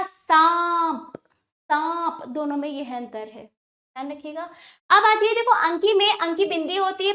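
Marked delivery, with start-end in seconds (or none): 0.0-2.2 सांप stretched very slowly on a nasal aa; open-mouthed nasal vowel. Shown as a demonstration. Teacher demonstrating chandrabindu, long nasalised सांप. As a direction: open the mouth and draw out सांप through nose and mouth. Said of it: "आपको मुख भी खोलना पड़ेगा".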